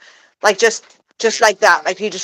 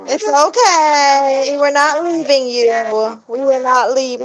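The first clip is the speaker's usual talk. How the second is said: reassuring pet talk; 6 semitones higher, 3 dB louder, slower than usual. Real, not acted. Soothing her pet bird.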